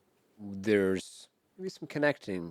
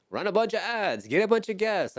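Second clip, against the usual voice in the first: high, loud mocking pitch-man voice; mock hype voice; 13 semitones higher, 5 dB louder than usual. Host mocking podcasters who chase ads and guests, high hype voice. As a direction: parody a slick marketer chasing numbers.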